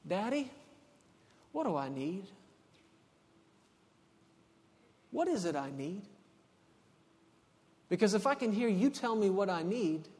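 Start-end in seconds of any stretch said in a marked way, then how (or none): 0.0-6.0 soft, lower, unhurried question; tender, childlike asking. Acted. Child coming before Daddy God, tender asking. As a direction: ask it softly, with simple trust.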